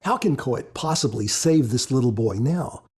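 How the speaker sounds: ordinary delivery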